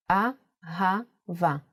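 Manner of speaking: very slow "Ahava", broken into A, ha, va with pauses; syllable by syllable. Shown as a demonstration. Teacher sounding out "Ahava" while writing it, slowly syllable by syllable. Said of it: "Let's do it once more in handwriting".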